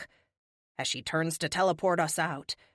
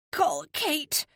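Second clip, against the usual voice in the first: grunted; 9 semitones higher than usual. Acted. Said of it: "I grunt".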